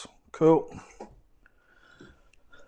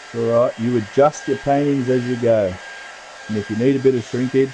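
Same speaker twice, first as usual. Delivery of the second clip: louder, over background noise; raised to carry over a steady roar; 3 semitones higher, 7 dB louder than usual. Real, not acted. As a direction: project over a noisy dryer. Talking over a noisy dryer.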